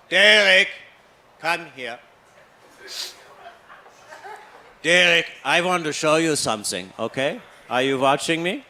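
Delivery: a dad teaching with fake patience; a father showing his son how to switch off the light; dad's voice.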